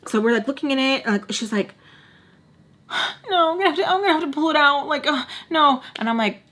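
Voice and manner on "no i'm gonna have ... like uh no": high-pitched, tearful whine in imitation; mock whining. Friend dreading having to pull it out, mock-tearful whine. Voicing friend upset about her piercing.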